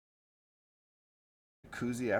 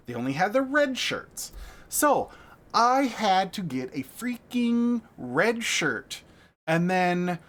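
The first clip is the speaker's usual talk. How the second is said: loud, high, worked-up ranting; 5 semitones higher, 5 dB louder than usual. Real, not acted. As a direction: vent it loudly, building up. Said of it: "Full rant mode".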